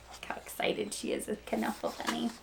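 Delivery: usual speaking voice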